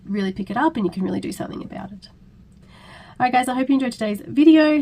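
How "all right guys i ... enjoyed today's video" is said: hoarse from a cold; croaky voice. Real, not acted. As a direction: talk through a sore, croaky throat. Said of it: "Sorry about the croaky voice".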